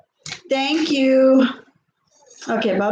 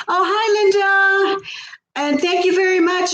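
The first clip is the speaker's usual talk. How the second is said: excited greeting; 6 semitones higher, slower than usual. Real, not acted. Greeting a viewer by name.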